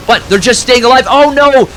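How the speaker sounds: high, loud caster burst; rising play-by-play excitement; excited shout. Real, not acted. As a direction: yell it as the unit nearly dies. Caster hype at near-death unit.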